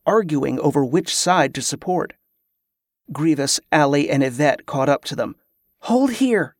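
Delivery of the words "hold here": raised-pitch, urgent warning. A narrator's reading. Kerla holding back her allies, high warning. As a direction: warn your companions to stop. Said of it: "Kerla warned".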